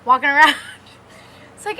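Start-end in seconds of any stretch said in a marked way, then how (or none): none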